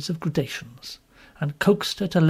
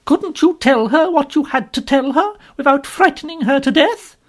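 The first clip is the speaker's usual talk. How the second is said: much higher, louder tirade; indignant scolding; 11 semitones higher, 6 dB louder than usual. Acted. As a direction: tear into the banker. Strong woman berating Mister Lorry.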